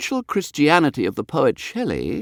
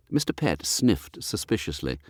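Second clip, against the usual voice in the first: plain reading voice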